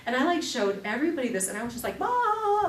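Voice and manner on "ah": high loud burst of laughter, replayed; a hooting laugh, much higher and louder. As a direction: cackle at the silly gadget. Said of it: "just laughing my head off".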